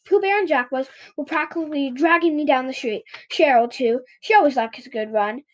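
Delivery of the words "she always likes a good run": ordinary delivery, nothing marked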